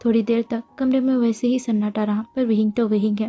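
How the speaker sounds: usual speaking voice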